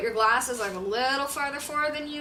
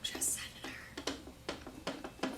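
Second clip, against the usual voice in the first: whispered; 19 dB quieter than usual. Acted. Co-host echoing herself in a whisper.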